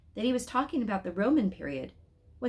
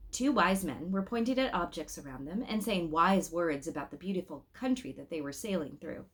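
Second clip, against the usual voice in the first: usual speaking voice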